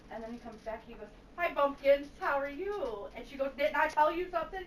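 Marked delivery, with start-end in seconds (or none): 1.4-3.1 high, louder chirpy hello; mock cheery greeting. Husband cheerfully greeting the wife who just scolded him, mock cheery. 3.6-4.6 high-pitched imitation of a telling-off; mock scolding. Wife telling off her husband, mock scolding.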